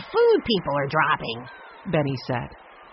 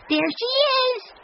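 put-on much higher, slow excited boy's shout; slower than usual. A narrator's reading. Benny shouts in delight when he spots Jessie. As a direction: spot her and call out with joy. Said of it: "Benny shouted".

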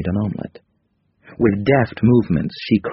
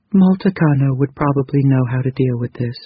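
higher, gentle female character voice; 6 semitones higher than usual. A narrator's reading. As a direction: voice Krita Kano lightly higher. Narrator voices Krita Kano.

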